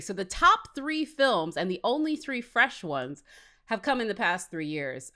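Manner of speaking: usual speaking voice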